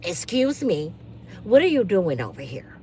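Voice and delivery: a hint of a nosy neighbour in her robe; prim Karen voice.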